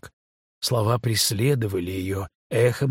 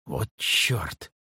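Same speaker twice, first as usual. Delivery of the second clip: sharp alarmed whisper; 6 semitones lower, 3 dB quieter than usual. A narrator's reading. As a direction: hiss it under your breath. Sudden alarm on the stairs.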